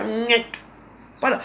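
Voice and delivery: ordinary delivery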